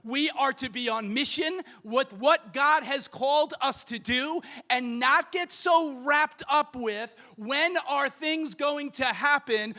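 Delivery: normal talking voice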